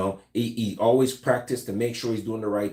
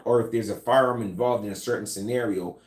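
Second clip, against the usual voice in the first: usual speaking voice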